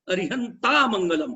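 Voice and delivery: अरिहंता लंबे आ के साथ, साफ़ साफ़; अरिहंता का अंत आ से खींचकर बोलें; सही उच्चारण. Shown as a demonstration. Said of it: "अरिहंत मंगलम नहीं बोलना है". (अरिहंता मंगलम, अंत में पूरा आ)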